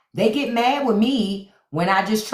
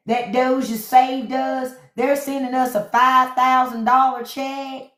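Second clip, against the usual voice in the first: mocking maga; 7 semitones higher than usual. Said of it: "maga was walking around talking about".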